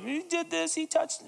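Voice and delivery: put-on high, tattletale child's whine; mock kid tattling on a sibling; whiny tattling kid. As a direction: tattle like a little brother.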